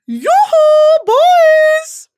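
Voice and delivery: high, loud squealing yoo-hoo; hollering in a forest. Pretend. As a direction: call to the boys like a lovesick fan. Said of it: "Me to Jay Ellis".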